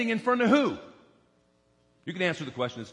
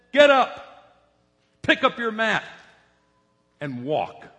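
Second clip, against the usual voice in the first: commanding call; 5 dB louder than usual. Retold or imitated. Pastor voicing Jesus to the lame man, loud firm command. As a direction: call it out with authority. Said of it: "Jesus said".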